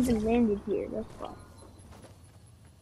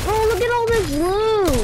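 excited; 9 semitones higher, 4 dB louder than usual. Real, not acted. Finds a pile of loot.

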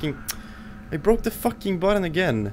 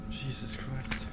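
whispering; 2 semitones lower, 17 dB quieter than usual. Real, not acted. Whispering after scare.

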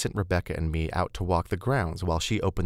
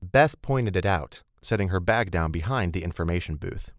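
plain reading voice

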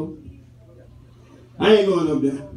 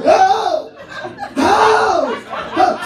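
mock pained cries; 8 semitones higher, much slower than usual. Acting out pain when walking.

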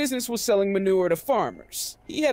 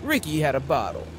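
usual speaking voice

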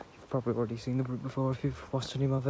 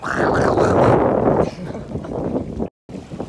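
loud laugh; 5 semitones higher, 8 dB louder than usual. Real, not acted. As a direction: burst of breathy laughter. Dog ran off, big laugh.

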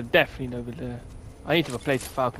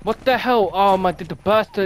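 higher and louder, real shock at the gun; outraged disbelief; 6 semitones higher, 8 dB louder than usual. Real, not acted. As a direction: blurt it out in disbelief. Gun jams, player outraged.

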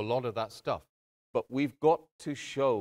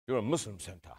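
a lightly voiced football casual, low and blunt; imitating a football casual; 4 semitones lower, 3 dB quieter, faster than usual. Preacher retaking the football casual's challenge, mild imitation. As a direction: retake the visitor's line closer to how he sounded. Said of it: "Bit like that".

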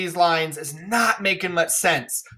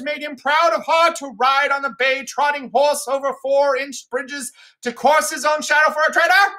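mad poor Tom; 10 semitones higher, 3 dB louder than usual. Acted. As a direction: rave like a madman fleeing fiends. Mad Edgar raving.

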